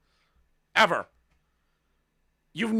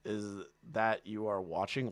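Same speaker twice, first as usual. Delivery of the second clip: ordinary talk, nothing marked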